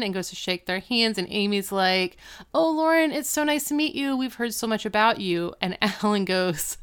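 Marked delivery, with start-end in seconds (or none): 2.5-5.5 high-pitched mock hostess greeting; gushing welcome. Mother meets the girl Lauren, high gushing welcome. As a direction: imitate a mother gushing at a guest.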